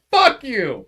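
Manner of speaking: high, loud mock yell at the cop; angry yell. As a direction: shout it at the officer like the drunk girlfriend. Drunk girlfriend swearing at the cop.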